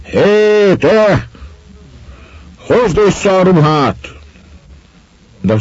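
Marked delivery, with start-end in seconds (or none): 0.0-4.1 high, loud policeman's shout; raised voice. A narrator's reading. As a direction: bellow the order at someone far off. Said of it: "A csendőr megeresztette a hangját". (csendőr kieresztett hangú kiáltása)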